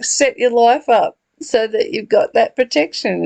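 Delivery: ordinary talk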